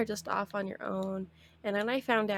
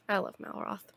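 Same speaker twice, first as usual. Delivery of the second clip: usual speaking voice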